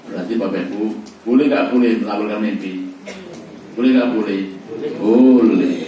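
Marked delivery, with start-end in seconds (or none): 5.0-5.8 emphatic answer. Acted. Answers his own question emphatically.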